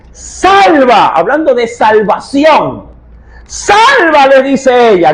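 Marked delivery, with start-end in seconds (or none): 0.1-1.1 Salva cried out high and loud, stretched; desperate cry. Shown as a demonstration. Preacher correcting the word to her cry, high loud plea. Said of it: "no, le dice". 3.5-4.3 much higher, loud pleading cry; desperate shout. Retold or imitated. Woman begging the king from her rooftop, desperate shout. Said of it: "gritando desesperadamente".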